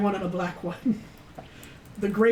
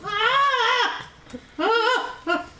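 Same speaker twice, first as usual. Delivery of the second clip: goat bleating scream; 13 semitones higher, much slower than usual. Acted. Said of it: "it sits on its hind legs and goes".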